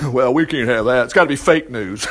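a low, laughing hint of a scoffing official; mild mock Pharisee brushing it off; lightly voiced dismissive Pharisee. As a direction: wave it off as fake news.

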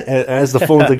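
ordinary talk

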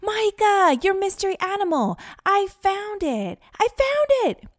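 elated yelling, peaking on the last words; excited calling out. A narrator's reading. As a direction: call across a beach with thrilled urgency. Calling across the beach.

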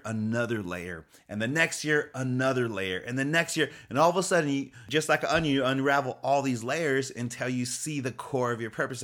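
ordinary talk

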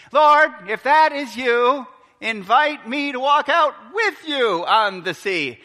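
a high, loud shout across the water; eager call. Retold or imitated. Peter shouting to Jesus on the sea, loud and eager. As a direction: call out boldly to the figure.

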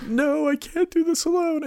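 pleading Wanda. Pretend. Grieving Wanda begging her husband, high and pleading. Wanda voiced in predicted scene.

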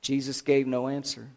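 usual speaking voice

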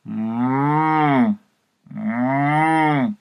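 long, drawn-out moo; storybook cow's call. Acted. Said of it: "And the cow called out".

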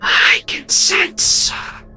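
unsympathetic ghostly whisper, slow; cold whisper. A narrator's reading. Ghost coldly telling Alf she senses his wife, whispering. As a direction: breathe it without pity. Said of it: "her voice void of any sympathy".

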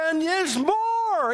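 high, stretched-out teaser; slow, high, hyped host voice; game-show announcer. Retold or imitated. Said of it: "then Jay would go".

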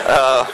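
embarrassed laugh. Real, not acted. Choking up, laughs it off.

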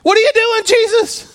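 high, loud shout of "What are you doing"; yell at God. Retold or imitated. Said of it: "You ever wanted to yell at God like that?".

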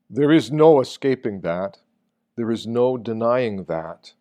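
ordinary talk

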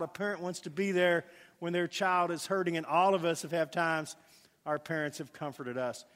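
ordinary delivery

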